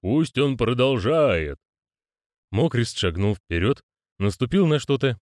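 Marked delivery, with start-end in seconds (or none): 0.0-1.6 slow, solemn proclamation; drawn-out resounding ritual voice; hollow proclaiming voice. Acted. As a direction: proclaim it with long, weighty vowels. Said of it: "провозгласил гулкий голос".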